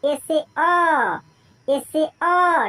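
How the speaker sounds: "ए से अ" said very slowly; slow spelling demo. Shown as a demonstration. Teacher slowly linking English "ए" to "अ" for students. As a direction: spell out each sound slowly. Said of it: "English ए लिखा हुआ है".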